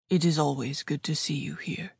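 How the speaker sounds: put-on measured speech with a light German accent; lightly voiced man with a German accent; slight German accent. A narrator's reading. Jacob welcoming a regular customer, slight German accent. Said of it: "in his slight German accent".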